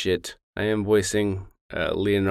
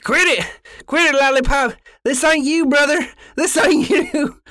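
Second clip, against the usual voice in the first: much higher, louder Southern yell; yelling country voice; 6 dB louder than usual. Retold or imitated. Yelling at his friend Lollipop in the country voice. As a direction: shriek it in the twangy voice, laughing at the end. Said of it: "I'd be like".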